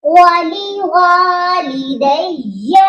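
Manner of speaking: ولی والدیہ slow, alif in والدیہ drawn long; stretched alif. Shown as a demonstration. Teacher repeats the stretched vowel slowly. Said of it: "کھینچ کر پڑھنا ہے".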